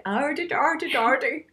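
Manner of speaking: mock pirate "ardy" growl; a light pirate ardy-ardy; pirate accent. Mock pirate voice for a Scottish accent request. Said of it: "all I can think of is a pirate accent".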